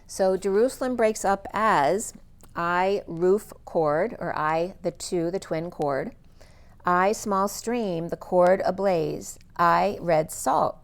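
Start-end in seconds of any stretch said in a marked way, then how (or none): none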